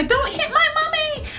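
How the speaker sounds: put-on very high childish pleading voice; imitating the little boy. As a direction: beg like a small kid, high. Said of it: "and say".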